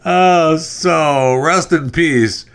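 mock mourning. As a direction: coo a pitying farewell over a little pet. Mock eulogy for dead monkey.

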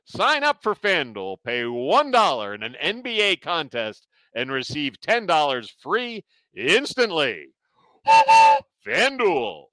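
upbeat sponsor read. Acted. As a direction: sell it like a radio spot. Reads sponsor ad.

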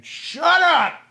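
high, loud imitated yell; imitated scream. Imagining her daughter yelling across the classroom, high and loud.

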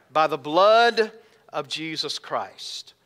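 preacher hammering the answer home; emphatic declaration. Shown as a demonstration. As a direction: proclaim it boldly. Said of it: "Don't be afraid to say it".

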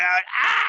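high loud yelp; fake pain yelp. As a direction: cry out as if hurt. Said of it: "I was like".

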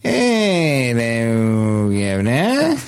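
slow Boston Kennedy drawl, dropping the r in "Governor"; Boston Kennedy accent. Retold or imitated. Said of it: "See if you can place this, uh, regional dialect".

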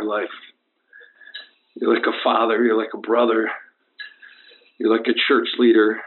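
low, halting, sentimental confession; deathbed farewell. Acted. Injured caller to his idol from hospital, weak deathbed farewell. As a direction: pause heavily between tributes, as if fading.

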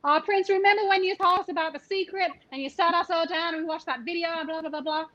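high-pitched, chatty imitation of an eager friend; gushing reminiscer. Friend reminding Prince of a past evening, gushing. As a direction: run on gushingly about old times.

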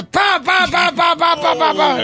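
high, rapid mock gunfire; spray bullets with your mouth; pa pa pa shooting sounds; gunshot noises. Imitated gunfire in joke.

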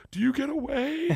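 put-on high-pitched little-kid question; imitated young daughter. Young daughter asking dad about his story, high and eager.

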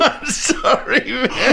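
high, slow laughing apology; can't stop laughing; laughing hard. Real, not acted. Laughing fit.

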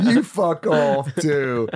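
loud, very slow, high mock English; mock backtalk accent. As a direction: drag out the comeback at Mum in a put-on accent. Acts out talking back to Mum.

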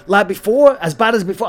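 retold anxious questioning; alarmed doctor questioning. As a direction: imitate a panicking doctor checking on pain. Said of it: "they're freaking out".

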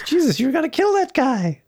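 put-on high, alarmed boyish voice; kid in a put-on voice. Kid warning the Terminator about the man. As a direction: squeak like a startled kid. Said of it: "he just says, goes".

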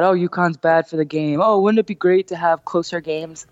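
lightly mocking dopey voice; a hint of a dopey complaining fan; dopey guy voice. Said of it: "In that tone of voice".

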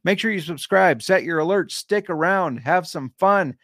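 bright, pushed-up sign-off pitch; upbeat promo pitch. Real, not acted. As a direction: sell the subscribe like an announcer. Upbeat outro call to action.

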